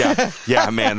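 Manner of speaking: high-pitched laughing yeahs. Real, not acted. Host's joke about spelling names.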